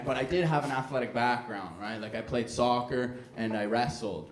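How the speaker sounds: normal talking voice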